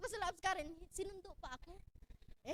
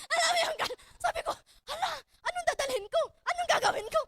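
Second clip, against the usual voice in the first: panicky excited; 9 semitones higher, 8 dB louder than usual. Retold or imitated. Panicked retelling of sudden trip.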